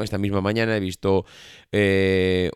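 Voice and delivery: ordinary talk, nothing marked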